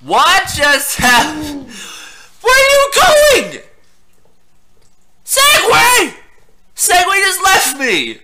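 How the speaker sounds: high, wailing yells of disbelief; distraught shouting. Pretend. Player yells after departing Segway, distraught high shout. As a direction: call after it in hammed-up despair. Segway rolls away in game.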